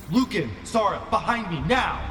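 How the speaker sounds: high, urgent order to get behind him; a protector barking at them to take cover; high urgent warning call; urgent command. Acted. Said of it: "and says".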